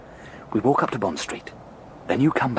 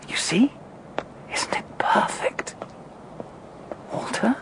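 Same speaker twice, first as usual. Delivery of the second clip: hushed gloating; 12 semitones higher, 4 dB quieter, slower than usual. Acted. Savouring the plan.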